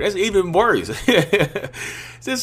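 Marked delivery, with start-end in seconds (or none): 0.9-2.2 high burst of laughter at own joke; real laugh. Real, not acted. Laughing after mocking the fake greeting, real high laugh. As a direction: laugh at your own silly impression.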